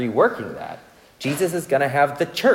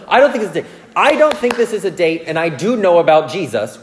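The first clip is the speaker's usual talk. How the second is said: higher, louder, insisting "I don't think it's a date"; flustered insistence; 5 semitones higher, 7 dB louder than usual. Retold or imitated. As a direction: protest in a fluster, pushing each "don't". Flustered insistence retold to Josephine.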